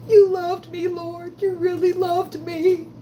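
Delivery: high, slow, choked-up thanks; tearful gratitude. Retold or imitated. Thanking the Lord for love, high slow tearful. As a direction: cry with relief at being loved. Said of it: "then you be thinking".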